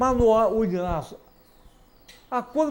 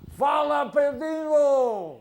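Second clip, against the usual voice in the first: calling out; 8 semitones higher, slower than usual. Real, not acted. Calling out greeting to Pedrinho.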